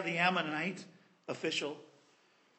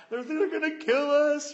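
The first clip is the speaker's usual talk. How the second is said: mock panic; 8 semitones higher, 7 dB louder than usual. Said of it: "they're all freaking out".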